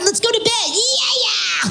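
high excited couple; giddy excitement. Retold or imitated. Couple excited about bedtime, high giddy. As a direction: squeal it with glee.

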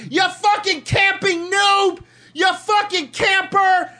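high, drawn-out shouted insults; acted gamer rage. Player yelling at Halo snipers who camp, acted rage. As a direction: scream it at the camper, stretching the words.

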